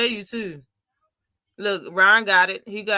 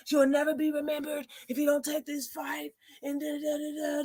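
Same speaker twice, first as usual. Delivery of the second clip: preacher; 6 semitones higher, 6 dB quieter than usual. Retold or imitated. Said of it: "talking like a coked up fucking preacher".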